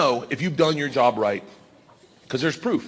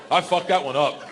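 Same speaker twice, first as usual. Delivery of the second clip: normal talking voice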